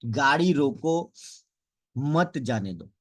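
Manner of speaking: "गाड़ी रोको", held up, pause, then "मत जाने दो"; pause after रोको. Shown as a demonstration. Speech coach showing how pauses change meaning, pause after रोको. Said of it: "मैं कहाँ रुकता हूँ, कहाँ पे पोज देना है".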